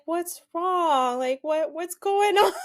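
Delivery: high, mock-concerned husband voice; imitating her husband asking what's wrong; mock worried husband. Friend mimics husband asking what's wrong, high mock concern. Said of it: "he's like".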